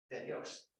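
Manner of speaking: hushed and reverent, barely voiced; a sacred aside whispered to the room. Real, not acted. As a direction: breathe it out as a whisper. Answering whose words these are.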